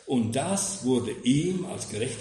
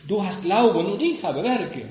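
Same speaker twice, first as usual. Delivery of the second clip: louder, higher challenging retort; voicing the smug objector, raised and pointed; mock objector; 5 semitones higher, 6 dB louder than usual. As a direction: throw the objection back like a heckler. Objector quoted in sermon.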